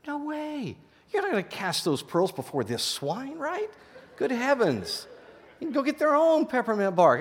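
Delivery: high-pitched, hammed-up selfish indignation; mock indignant refusal. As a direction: scoff in a high, put-on voice. Mock snob refusing to share treat.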